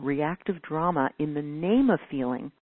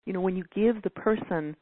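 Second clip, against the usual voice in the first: ordinary talk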